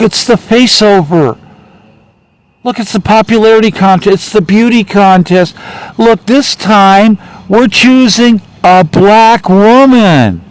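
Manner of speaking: hammed-up hype, pitched well up; mock excited pitch. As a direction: sell it like a gimmick, mockingly eager. Mockingly voices the party's pitch.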